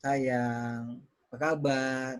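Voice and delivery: low, drawn-out tender greeting; talking to wife. Shown as a demonstration. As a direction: linger softly on sayang and kabar. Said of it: "ngomong ke istri".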